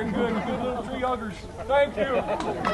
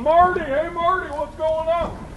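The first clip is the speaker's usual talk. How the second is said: loud, rushed, higher greeting shouted at the tree; bursting in on Marty; 6 semitones higher, 4 dB louder than usual. Shown as a demonstration. Showing folks how not to greet the tree, loud and rushed. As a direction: barge in on him with a loud cheerful hail. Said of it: "you can't just run up to him and go".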